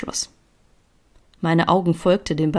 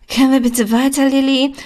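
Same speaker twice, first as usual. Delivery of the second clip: high, loud, whiny urging; impatient; 8 semitones higher, 7 dB louder than usual. A narrator's reading. Annoyed blonde hurrying her friend along, impatient. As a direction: push to get moving, pitched up.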